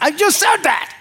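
high-pitched, laughing I just said that; mock-offended falsetto; high indignant protest. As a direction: squeak out the protest in disbelief. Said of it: "And I'm like".

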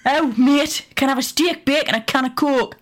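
a second Geordie attempt that still misses the mark; Geordie accent. Shown as a demonstration. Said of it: "it's terrible".

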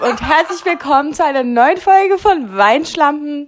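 overdriven, clipping mic; blown-out loud intro; fuzz distortion. Real, not acted. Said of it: "Du übersteuerst so hart". (übersteuerte Begrüßung)

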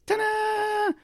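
high, sustained sung ta-da; high-pitched reveal jingle; mock fanfare. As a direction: trill a little triumphant flourish, held long. Imitates door's triumphant fanfare.

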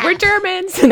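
high, loud, playful announcement; jokey caught-out soldier, on the edge of laughter; mock German soldier. Soldier answering the trench he broke into, playful announcement.

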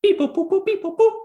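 high robotic beep boop noises. Pretend. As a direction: chirp like a little robot. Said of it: "ask the E T F five bot".

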